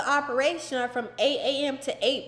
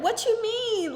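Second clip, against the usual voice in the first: stunned; 9 semitones higher, slower than usual. Retold or imitated. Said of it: "They were like".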